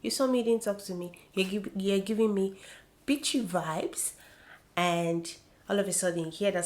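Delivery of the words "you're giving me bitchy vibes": ordinary talk, nothing marked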